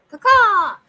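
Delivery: high shrieking crow caw; loud crow caw. Acted. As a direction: screech it like a crow.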